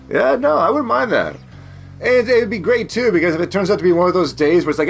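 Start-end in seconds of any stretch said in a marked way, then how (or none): none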